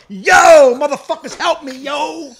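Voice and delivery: high, loud holler; mock rude customer. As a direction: yell for help like you own the place. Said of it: "Just walk in the store".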